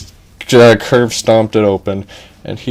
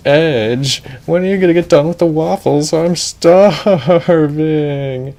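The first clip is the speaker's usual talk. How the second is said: higher, whiny nagging, stretching starving; impatient princess; 7 semitones higher than usual. Acted. Princess hurrying fiancé for waffles, higher whiny nagging. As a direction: nag him to hurry.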